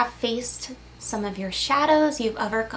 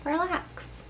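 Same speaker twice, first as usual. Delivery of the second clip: higher, softer, lilting up on "Relax."; rising relax; 5 semitones higher, 9 dB quieter than usual. Acted. Coaxing the viewer again, "Relax." rising high and soft. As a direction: lift "Relax." gently upward.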